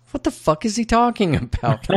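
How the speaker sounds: can't believe what he just heard; incredulous. Real, not acted. Baffled by bizarre dialogue.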